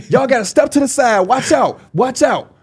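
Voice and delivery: high bossy usher voice; usher lady. Retold or imitated. Little usher lady ordering the crowd aside, high and bossy. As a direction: clear the way like a tiny bouncer.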